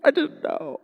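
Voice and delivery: high, loud, pleading mock apology with a voice cracking up; hammed-up panicked sorry to Jesus, pitched high; frantic apology. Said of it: "And I'm like".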